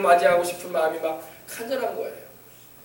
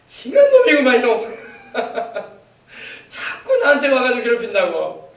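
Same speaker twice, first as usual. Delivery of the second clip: joking Jeolla dialect, high and loud; 전라도 사투리로 우스개; 4 semitones higher, 4 dB louder than usual. Retold or imitated. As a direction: tease him in Jeolla dialect. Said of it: "전라도 사투리로 우스개 표현을".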